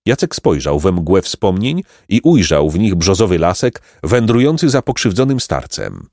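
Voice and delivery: ordinary delivery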